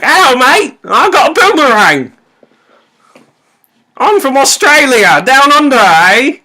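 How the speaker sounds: high, loud, hammed-up Australian accent; bad Australian accent. Gamer shows friend a bad Australian accent. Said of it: "say something in a bad Australian accent".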